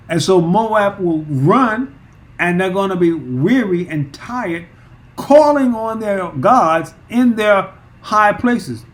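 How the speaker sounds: fiery pulpit preaching easing off; impassioned preaching. Acted. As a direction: build the call to the high places, then let it drop. Preacher builds to full force.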